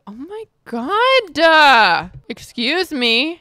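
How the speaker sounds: mock scandal at the screen; scandalized. Real, not acted.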